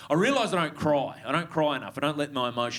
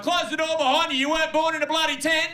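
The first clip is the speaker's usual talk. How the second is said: much higher, louder nagging shout; fed-up dad yelling; 12 semitones higher, 4 dB louder than usual. Retold or imitated. Dad shouting at his kid about the door, a high nagging yell. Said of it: "a dad say something like".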